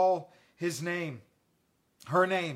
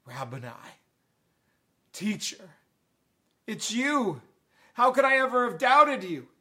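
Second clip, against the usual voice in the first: starts hushed, builds to a loud, high cry; yelling out in recognition; 5 semitones higher, 7 dB louder than usual. Acted. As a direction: let stunned recognition swell into an outcry. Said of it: "she yells out".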